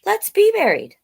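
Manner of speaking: squeaky eager worm saying yes; tiny worm agreeing, high; high little worm voice. Acted.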